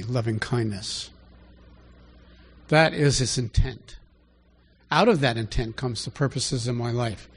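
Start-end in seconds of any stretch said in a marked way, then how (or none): 0.0-1.1 softer and slower, savouring loving; tender lingering. Shown as a demonstration. Preacher dwelling on God's heart, soft and slow. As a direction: let loving kindness melt out gently. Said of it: "that it's about loving kindness".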